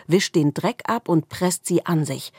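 usual speaking voice

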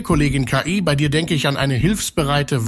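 ordinary talk, nothing marked

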